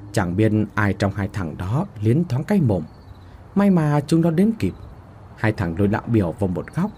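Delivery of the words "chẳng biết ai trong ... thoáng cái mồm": usual speaking voice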